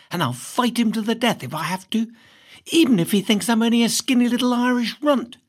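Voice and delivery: plain reading voice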